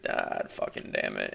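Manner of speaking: low, unvoiced muttered curse under breath; whispered frustrated curse. Real, not acted. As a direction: grumble it to yourself. Frustration at losing.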